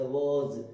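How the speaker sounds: "was" said as "woz", with a rounded o, not "wa"; was with vo sound. Shown as a demonstration. Teacher shows students the right vowel, slow and stressed. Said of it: "वा नहीं वो".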